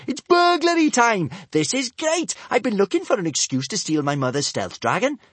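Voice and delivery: very high, loud excitement; girl thrilled by burglary. A narrator's reading. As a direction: bubble over.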